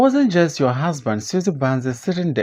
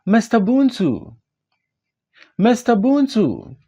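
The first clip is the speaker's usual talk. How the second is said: shouting; 7 semitones higher than usual. Acted. Drunk man shouting for his friend Buntu in the street. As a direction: call across the street at full voice.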